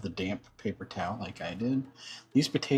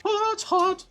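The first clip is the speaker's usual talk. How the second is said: sudden high yelp; real startled yelp at the heat. Real, not acted. Touching the hot oven.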